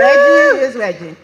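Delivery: high, loud, gleeful shout; excited shout-out. Real, not acted. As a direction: hype him up to the crowd. Hyping up friend in audience.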